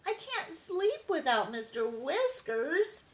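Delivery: high, pleading little kitten voice; a small kitten close to tears over his toy; high, upset kitten; crying kitten. A narrator's reading. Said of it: "cried Andy".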